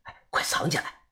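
hushed, voiceless warning; urgent whisper. A narrator's reading. Old man warns his companion to hide it, urgent whisper. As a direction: whisper it so nobody else hears. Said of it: "小声的跟我说".

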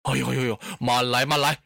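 put-on low, flustered old lord; frightened pleading. A narrator's reading. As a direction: plead for a halt, voice pitched well down. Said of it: "可把董卓给吓坏了".

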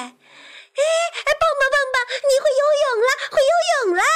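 very high, loud jubilant shout; cheering. A narrator's reading. Frog cheering that his friend can swim, high excited shouting. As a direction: cheer wildly in a high character voice. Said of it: "跳跳欢呼起来".

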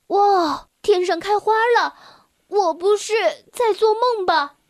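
put-on high, bright childlike wonder; amazed. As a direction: gasp with delight. Boy sees fireworks. (惊喜万分)

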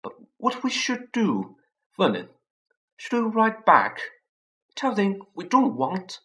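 high, anxious pleading; worried high voice. Acted. Petunia anxiously asks Vernon, high and worried. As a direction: fret in a thin high voice.

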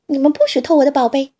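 loud, fast, indignant yell; guard your acorns, fast and loud; angry shout. Acted. Squirrel protecting acorns from badger and fox, fast angry yell. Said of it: "松鼠叫起来".

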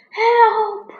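a high, loud cry for help; calling for help. Acted. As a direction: shout it out, trapped. Lion caught in trap.

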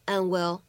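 "and will" linked, the d in "and" held back unreleased; incomplete plosion. Shown as a demonstration. Teacher shows learners a linking trick, "and will" with unreleased d. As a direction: run "and will" together without releasing the d. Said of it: "类似于不完全爆破的处理".

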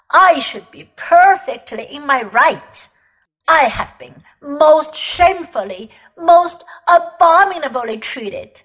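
put-on offended old gentleman ranting; huffy, self-righteous tirade rising; pompous indignation. Uncle Andrew's indignant tirade.

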